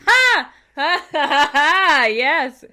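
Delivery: loud gleeful cackle; triumphant laugh. Real, not acted. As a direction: crow with delight. Triumphant laughter over joke.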